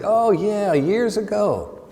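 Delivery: high, drawn-out oh yeah of remembering; sudden recollection. Retold or imitated. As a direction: lift it high as it comes back to him. Stranger finally remembers the tailor.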